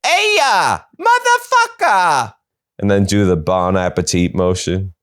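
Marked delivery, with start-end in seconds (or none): 0.1-2.3 very high cartoon Italian, slowly stretched; hammed-up road-rage Italian; mock Italian yell. Althea cut off in traffic, in a mock Italian shout. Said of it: "be like".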